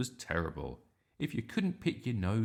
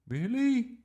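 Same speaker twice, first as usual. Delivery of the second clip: surprised; 8 dB louder than usual. A narrator's reading. Miss Boots voiced, surprised.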